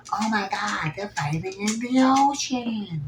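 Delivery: high-pitched shocked squeal of a bystander; excited gasp. Retold or imitated. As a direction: squeal it like you can't believe your eyes. Bystander shocked at ocean fight.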